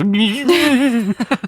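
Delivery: high-pitched mock electrocution buzz; electric shock noise. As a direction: squeal like a man zapped on power lines. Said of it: "Felix that used to work on the power lines".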